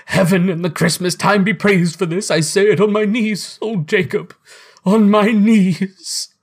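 high, impassioned thanksgiving; fervent, overcome gratitude. Acted. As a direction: give thanks as if on your knees, overwhelmed. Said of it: "his broken voice would scarcely answer".

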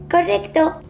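put-on high little boy voice; child voice. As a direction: agree like a trusting small child. George agrees.

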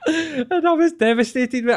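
laughing through the word, voice jumping high. Real, not acted. Telling his mate about rejection, laughing. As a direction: crack up while claiming devastation. Laughing at own childhood heartbreak.

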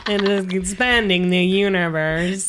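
slow, measured broadcaster voice; mock-grand science presenter; Moira Stewart impression. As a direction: put on a documentary narrator, drawn out. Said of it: "Do a Moira Stewart again".

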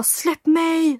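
drawn-out, high cry of retold panic; retold scream. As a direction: imitate a panicked shriek at held-back volume. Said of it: "Så jag skriker och bara".

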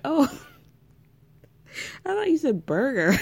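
giggling through the words; can't hold back the laugh. Retold or imitated. Woody giggling at his own burger mistake.

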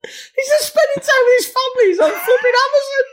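high shrieking laugh through words. Real, not acted. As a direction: crack up while ranting. Outraged laughing rant at review.

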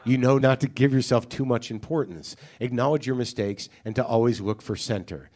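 low, measured, solemn reading; email read aloud. Acted. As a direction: read it like earnest poetry, letting each phrase land. Reads a listener's poetic email aloud.

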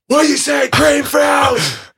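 high, strained hype-man yell; re-enacted MC roar; stage shout. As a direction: holler a greeting to a festival crowd. Retelling his shout from stage.

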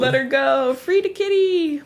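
high squeaky cry to free the cat; high mock plea. Pleading for hostage cat.

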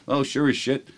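usual speaking voice

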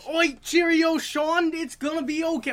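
high, loud hammed-up Australian-British accent; mocking Sean's father comforting him, loud stage accent; mock British dad. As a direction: reassure like a crocodile hunter. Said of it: "Why am I the crocodile hunter?".

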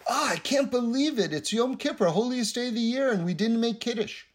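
high-pitched mock astonishment; exaggerated dismay. One follower to another on Yom Kippur, exaggerated dismay. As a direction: exclaim it high, as if scandalized. Two followers staged exclaiming for newcomer's benefit.

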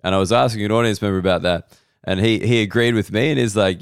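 ordinary talk

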